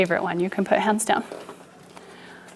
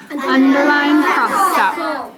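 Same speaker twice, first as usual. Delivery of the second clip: slow, higher "underline cross out"; silly voice; 4 semitones higher, slower than usual. Shown as a demonstration. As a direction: drag it out in a goofy voice. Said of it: "Nope, in a normal voice".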